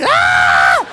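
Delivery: imitated laughing shriek; loud, long mock howl of laughter; dying laughing. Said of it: "he is dying".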